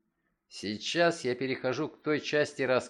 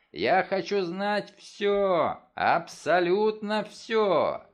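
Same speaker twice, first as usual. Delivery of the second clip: slow, loud, higher demand; insistent shout; 6 semitones higher, 4 dB louder, much slower than usual. A narrator's reading. Frantic father demanding the whole truth, slow shout. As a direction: insist on every word.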